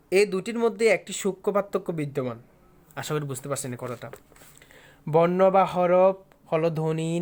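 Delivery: loud, emphatic textbook reading; formal definition reading. Real, not acted. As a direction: read the definition out loudly and deliberately.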